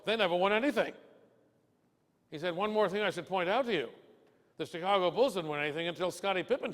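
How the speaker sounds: lightly voiced Bill setting the record straight; mildly emphatic old pro; lightly voiced Bill lecturing.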